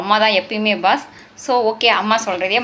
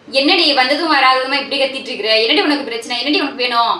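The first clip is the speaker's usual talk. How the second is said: snapping, raised-voice questions; irritated mother; 4 dB louder than usual. Acted. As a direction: demand to know what she wants. Mother snaps at calling daughter. (எரிச்சலில் சீறும் அம்மா)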